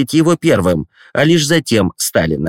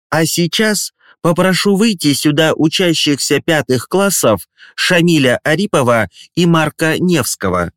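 solemn headmistress; 6 semitones higher than usual. Acted. Headmistress calls pupils forward.

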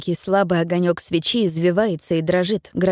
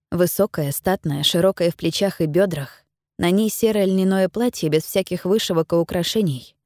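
plain reading voice

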